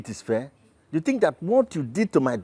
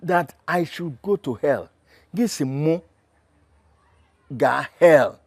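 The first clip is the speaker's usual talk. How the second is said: ordinary talk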